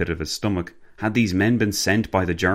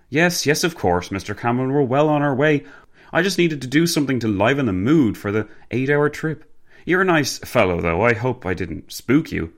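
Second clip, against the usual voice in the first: higher-pitched jovial gentleman; hearty gentleman, reassuring; 6 semitones higher than usual. Acted. Prankster reassuring the passenger after the joke, hearty.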